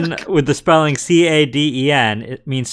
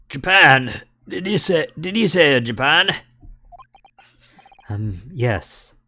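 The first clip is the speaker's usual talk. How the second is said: Scottish governor. Acted. Governor voiced in game.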